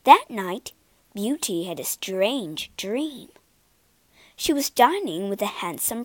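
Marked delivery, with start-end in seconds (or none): none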